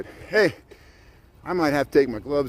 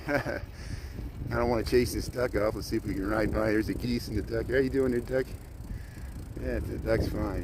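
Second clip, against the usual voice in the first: softer, hushed so as not to scare the birds; lowered voice; 3 semitones lower, 6 dB quieter than usual. Real, not acted. Riding past a duck on the ice, speaking softly. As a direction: keep it low passing the duck and geese. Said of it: "so I'll slow down and lower my voice".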